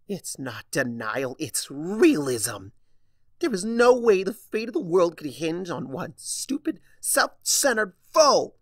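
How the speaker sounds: loud, high furious yell; angry shout. Acted. Starlight shouting at Discord, furious. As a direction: spit out the insult.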